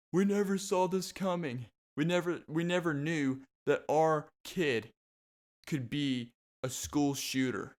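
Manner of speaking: hammed-up parent playing innocent on the news, no real tears; mock crying parent. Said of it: "they'll just be crying, going".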